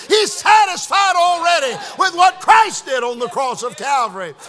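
high, loud preacher's exhortation; full-force preaching. Real, not acted. Telling the congregation God can use anyone, full-force preaching.

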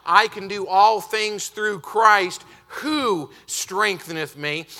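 "all" drawn out, slow and loud; emphasis on all. Shown as a demonstration. Preacher quoting the verse to his congregation, slow and loud. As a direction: build the verse slowly to full voice. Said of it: "When you change that".

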